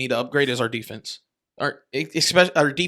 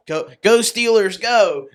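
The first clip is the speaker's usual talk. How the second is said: loud, slow mock cheer for the Steelers; mock rowdy Steelers fan; 5 semitones higher, 6 dB louder, slower than usual. Friend mocks a Bills fan at Jags game, mock loud cheer. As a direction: ham up a lone away fan cheering. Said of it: "you'll be that one guy, like".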